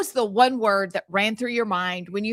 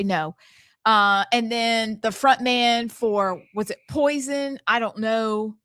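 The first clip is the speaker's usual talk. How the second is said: normal talking voice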